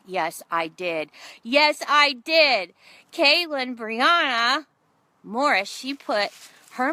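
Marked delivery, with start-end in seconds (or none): 0.1-1.1 slow, low and measured; deliberate admission. Retold or imitated. Speaker owning up to her mistake, deliberate admission. Confesses to the mishap, deliberate first take. 1.4-5.7 slow, raised and very loud; loud emphatic admission. Retold or imitated. Mother repeating her confession to the room, loud emphatic admission.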